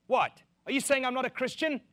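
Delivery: loud, high, indignant disbelief; mock someone taking offence; offended churchgoer. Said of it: "when people say".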